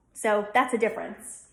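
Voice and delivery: ordinary talk, nothing marked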